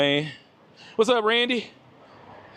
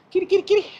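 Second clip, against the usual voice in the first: high sing-song kitty call; coaxing a cat over with a high call; 14 semitones higher, much faster than usual. Real, not acted. Calling the cat.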